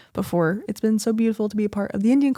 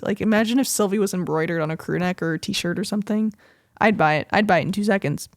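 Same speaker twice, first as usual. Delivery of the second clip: usual speaking voice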